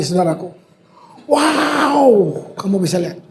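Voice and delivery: normal talking voice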